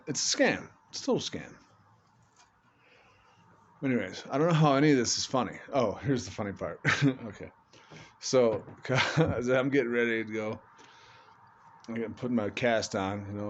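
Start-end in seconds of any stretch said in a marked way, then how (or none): none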